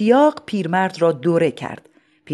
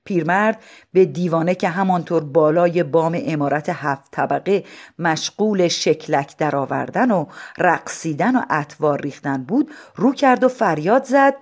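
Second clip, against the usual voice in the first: ordinary delivery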